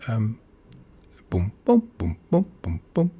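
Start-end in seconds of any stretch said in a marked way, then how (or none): 1.3-3.1 imitated piano bass. Shown as a demonstration. Presenter showing the stride piano's left-hand octaves, imitated piano bass. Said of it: "going like that".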